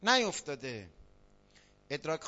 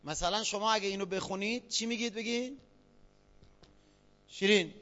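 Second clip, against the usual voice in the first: ordinary talk, nothing marked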